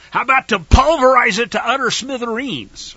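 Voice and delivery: PULVERIZE punched loud and high; emphatic. Shown as a demonstration. Teacher contrasting a stronger verb, PULVERIZE said emphatically. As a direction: hit PULVERIZE hard, keep it forceful through SMITHEREENS. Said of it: "it's a more emphatic, more action-oriented".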